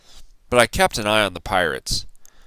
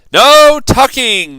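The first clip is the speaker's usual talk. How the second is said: much higher, loud slow cry; crew shout; 12 dB louder, much slower than usual. A narrator's reading. Pirate crew refusing bedtime, loud shout. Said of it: "the crew cried".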